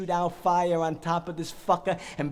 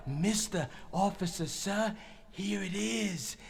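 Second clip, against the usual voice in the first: slow, soft mock politeness; mock obedience; 7 dB quieter, slower than usual. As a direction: hand it over with quiet menace. Mock obedience before firing.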